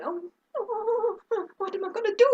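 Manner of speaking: high, whimpering retold panic; mock panic. Retold panic, audience watching.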